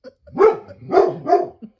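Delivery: high, loud burst of laughter; loud laugh at the dog. Real, not acted. Owner laughing at her dog, high and loud. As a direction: laugh at the dog's antics.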